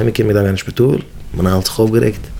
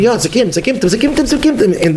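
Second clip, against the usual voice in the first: higher, rising excited repetition; worked-up, climbing pitch; mounting excitement; 7 semitones higher than usual. Retold or imitated. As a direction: build the anticipation with each repeat. Acting out people's mounting anticipation.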